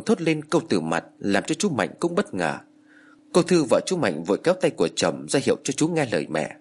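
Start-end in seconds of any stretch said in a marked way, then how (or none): none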